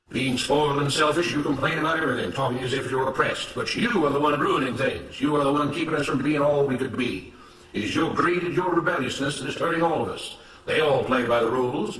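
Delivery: firm, emphatic denunciation; accusing, righteous overseer. Acted. Mister Smith blaming Samuel before the other workers, accusing. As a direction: lay the blame with self-righteous conviction.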